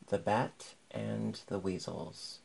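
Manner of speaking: plain reading voice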